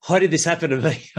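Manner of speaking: high, loud, bewildered whine; mock confused wail. Mocking clueless people, high exasperated cry. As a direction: play the baffled person who can't believe it. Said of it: "throw their hands in the air with confusion".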